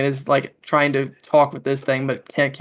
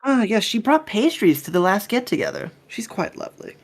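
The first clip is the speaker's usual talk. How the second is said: higher, genteel captain making small talk; chatty captain character; 6 semitones higher than usual. Acted. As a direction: play a pleased captain, pitched up. Game master plays the captain.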